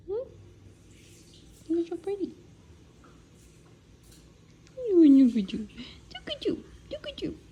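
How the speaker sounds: very high doting coo. Real, not acted. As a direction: fuss over the pet sweetly. Cooing to her pet.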